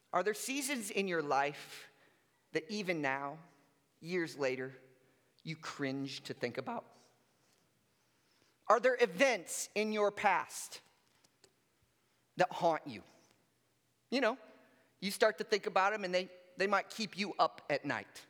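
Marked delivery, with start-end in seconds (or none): none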